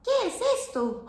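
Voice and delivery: high curious qué es esto; surprised question. Acted. Leo spots something new on the farm, surprised. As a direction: ask it with wide-eyed wonder.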